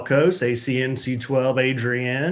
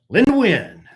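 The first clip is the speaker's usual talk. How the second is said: excited greeting; 10 semitones higher, 9 dB louder than usual. Real, not acted.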